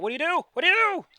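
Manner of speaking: high, loud, escalating question; urgent demand. Acted. Game master demanding Olaf's next move, shouting. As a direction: press them loudly, climbing on the repeat.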